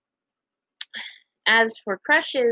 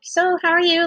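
high-pitched cheery small talk; cheerful; 8 semitones higher, 3 dB louder than usual. Retold or imitated. Girl making small talk with a classmate, cheerfully. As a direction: imitate yourself being chirpy. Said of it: "I was, like, cheerful and stuff".

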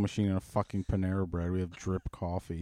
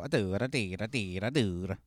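mock Italian. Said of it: "who don't go".

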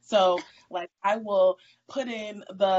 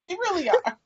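laughing; 11 semitones higher, 6 dB louder than usual. Real, not acted. Laughing agreement about morning kids.

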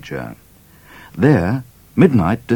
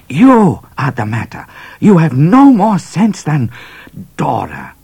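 much higher, louder furious cat; angry Cat; 10 semitones higher, 6 dB louder than usual. A narrator's reading. Cat scolding Midnight, high and furious. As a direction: scold him hard. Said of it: "he was so angry".

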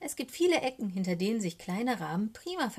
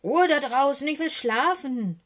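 loud, high irritated shout; angry scolding; 7 semitones higher, 10 dB louder than usual. A narrator's reading. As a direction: yell crossly for silence. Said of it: "schimpft Löffel".

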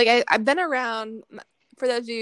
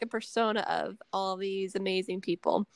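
normal talking voice

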